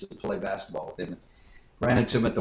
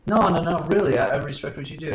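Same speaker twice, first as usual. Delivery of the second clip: louder, higher no no no, rushed; retold friend protesting loudly; insistent protest; 5 semitones higher, 6 dB louder, faster than usual. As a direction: insist hard, talking fast. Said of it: "And he goes".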